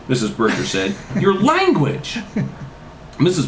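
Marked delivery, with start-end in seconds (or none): 1.2-2.2 high, drawn-out reproach; hammed-up maternal scolding; scolding mother. A narrator's reading. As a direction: stretch out a shocked mother's rebuke. Mother scolding her daughter's swearing.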